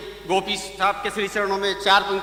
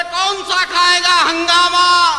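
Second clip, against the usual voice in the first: much higher and louder, slow and drawn out; loud slow call; 7 semitones higher, 6 dB louder, slower than usual. Retold or imitated. Friend calls to the poet at the third door, slow loud call. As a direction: bellow the question slowly at the peak. Friend asks, climax of the repeats.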